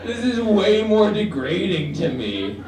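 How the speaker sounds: playing the insulted guy, half laughing; mock outrage. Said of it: "Be like".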